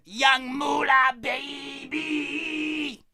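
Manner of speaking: high, drawn-out mock yell. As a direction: imitate a rapper hollering a slow catchphrase. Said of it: "[name] yells as if his microphone don't work".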